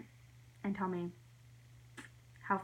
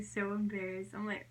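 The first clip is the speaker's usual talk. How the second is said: ordinary delivery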